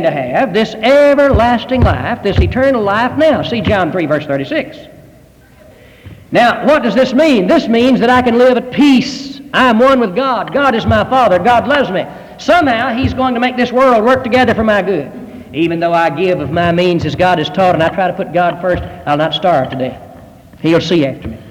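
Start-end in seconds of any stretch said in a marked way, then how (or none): 6.3-15.1 higher, slow pulpit assurance; preaching. Acted. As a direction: proclaim the peace of God.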